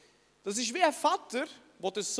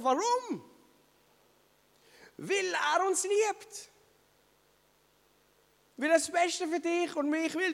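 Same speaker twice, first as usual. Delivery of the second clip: higher, emphatic preaching voice; 6 semitones higher than usual. Acted. Preacher pressing the congregation on why God grieves, emphatic. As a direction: drive the point home with conviction.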